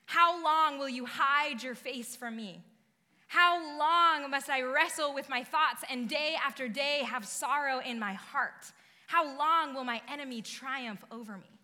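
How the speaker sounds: loud, impassioned psalm reading; lamenting psalm read with force; scripture reading. Shown as a demonstration. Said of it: "verses one and two say".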